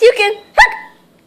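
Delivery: speech broken by a hiccup; slow words with a hiccup. Acted. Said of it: "you have the hiccups".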